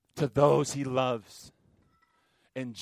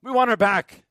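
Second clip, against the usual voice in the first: loud, higher plea pressing on want; pleading cry; 9 semitones higher, 10 dB louder than usual. Acted. Preacher voicing the congregation's prayer, loud pleading cry. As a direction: cry out for the sister back. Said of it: "we pray".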